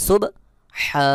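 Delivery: pressed mid-throat h in হা, vowel held long; সুব, then a long হা pushed from mid-throat; correct throat H. Shown as a demonstration. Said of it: "সহি উচ্চারণ হলো". (হা চাপ দিয়ে, টেনে উচ্চারণ)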